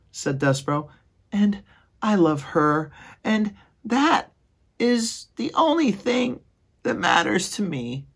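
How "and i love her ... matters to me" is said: very high, slow, dreamy squeak; lovestruck little mouse. Acted. Despereaux asking his brother about love, very high and dreamy. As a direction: float the question like he's in a daze.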